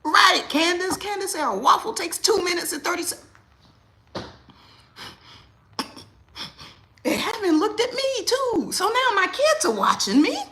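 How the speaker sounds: high, loud, exasperated; loud high fed-up venting; worked up rant. Real, not acted. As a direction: keep the fury going. Heated rant continues.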